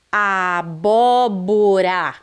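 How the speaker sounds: "Abóbora" bem esticado, sílaba por sílaba, R leve; R brando. Shown as a demonstration. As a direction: alongue "Abóbora" com um toque suave no R. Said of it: "ele está brando". (erre brando em "Abóbora", bem devagar)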